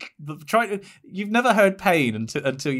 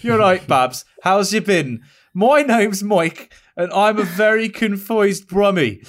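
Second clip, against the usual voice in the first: put-on higher, slow Birmingham accent read; Brummie accent; 4 semitones higher, 4 dB louder, slower than usual. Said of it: "I'm reading that as phonetically as I can".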